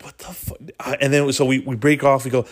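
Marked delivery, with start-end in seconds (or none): 0.0-0.6 breathy, stunned whisper. Retold or imitated. Four friends staring at each other, whispered shock. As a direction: mouth it to your friends. Confused looks between friends.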